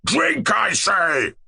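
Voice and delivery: slow, high-pitched tyrant's shout; shouted order. A narrator's reading. Monster king forcing dwarf to drink, shouting. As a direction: bellow it as a drawn-out command. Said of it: "shouted the monster".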